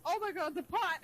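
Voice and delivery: high, excited exclamation; delighted astonishment; excited surprise. Real, not acted. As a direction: react to a sudden discovery. Echoing the startled discovery.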